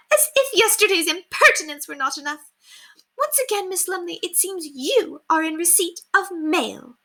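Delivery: high, sneering society lady; haughty scolding lady. Acted. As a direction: rebuke the maid from on high. Lady Constance scolds again.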